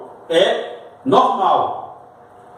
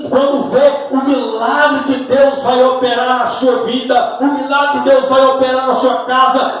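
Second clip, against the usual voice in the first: high, loud, driving sermon delivery; raised, insistent pulpit voice; full-force preaching; 6 semitones higher, 4 dB louder than usual. Real, not acted. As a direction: preach it at full force, building to the last line.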